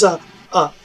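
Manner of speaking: ordinary talk, nothing marked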